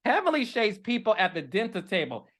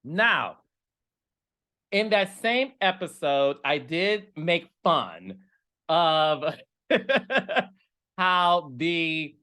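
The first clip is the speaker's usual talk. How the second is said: ordinary delivery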